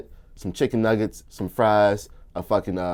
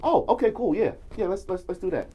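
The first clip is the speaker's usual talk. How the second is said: eager woman; 8 semitones higher, 5 dB quieter than usual. Retold or imitated. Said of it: "she was like".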